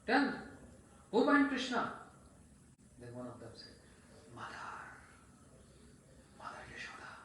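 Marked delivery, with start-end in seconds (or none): 0.0-1.9 loud, high shouted order; furious father pressing frightened boys; angry demand. Acted. 4.3-7.1 hushed, whispered confession; scared boy barely daring to tell; reluctant and scared. Acted. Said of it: "a little reluctant and scared".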